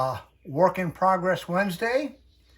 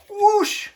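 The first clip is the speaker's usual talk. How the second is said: high, loud whoosh; mock whoosh sound; 7 dB louder than usual. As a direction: make the magic swoosh noise.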